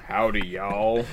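low, soft cowboy drawl. Acted. [name] playing along after his accent slip, cowboy. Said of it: "[name]'s inner cowboy broke loose".

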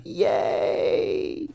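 soft, drawn-out mock "yay"; feebly faked little hooray; mock cheer. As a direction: cheer half-heartedly under your breath. Mock cheer at meeting the mom.

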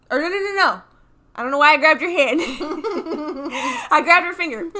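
sudden loud flustered protest; jolted, blurting it out fast; startled. Real, not acted. Caught off guard, blurting to her friend.

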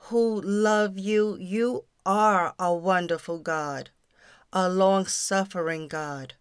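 ordinary talk

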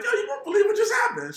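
high, loud excitement; excited. Retold or imitated. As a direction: burst out with the news, way up high. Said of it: "and I'm like".

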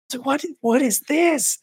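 high, drawn-out baffled squeak; alarmed disbelief. Retold or imitated. Man discovers blood after wiping, high-pitched disbelief. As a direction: slowly question it in a thin, high voice. Retold shock at finding blood.

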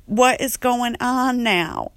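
high, loud, slow alarmed sass; Andre getting worked up. Retold or imitated. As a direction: staff member growing alarmed at the food. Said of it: "starting to get a little like".

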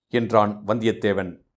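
ordinary delivery

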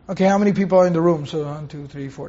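a quiet, offhand head count of the room; lightly voiced casual counter, trailing off softly; mock head count. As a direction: mutter the numbers like casually counting people. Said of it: "take account like that".

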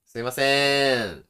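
slow, loud hail held long; drawn-out projected summons; stretched-out call. Shown as a demonstration. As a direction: let the call ring out at length. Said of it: "これだけでもだいぶ違いますよ".

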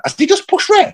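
very high-pitched shocked squeal of disbelief; dead high voice. Retold or imitated. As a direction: retell it in a squeaky, incredulous falsetto. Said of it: "every voice was dead high".

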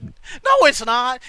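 sharp high loud no. Real, not acted. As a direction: cut them off with a loud no. Emphatic denial.

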